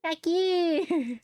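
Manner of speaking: put-on high, sweet anime girl voice; cute anime girl voice. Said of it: "just the cutest little anime girl voice".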